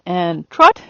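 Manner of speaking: TROT sharply rising from a low "and"; upward transition command. Shown as a demonstration. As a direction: send the voice up on "trot". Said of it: "the voice goes up".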